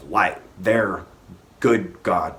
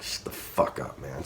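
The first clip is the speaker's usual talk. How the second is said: muttered retort; 4 semitones lower, 7 dB quieter than usual. Retold or imitated. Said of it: "And then I'd be like".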